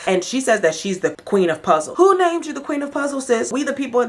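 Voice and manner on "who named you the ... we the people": much higher, incredulous call-out; indignant challenge. Acted. Calling out a self-crowned puzzle queen, indignant. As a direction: challenge her in a raised, offended pitch. Mock challenge to Ashley's boast.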